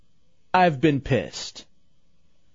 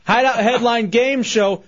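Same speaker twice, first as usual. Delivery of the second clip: game show intro; 5 semitones higher, 4 dB louder than usual. Acted.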